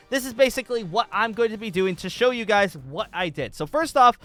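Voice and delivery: normal talking voice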